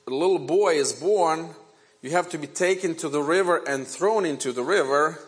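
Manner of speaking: ordinary talk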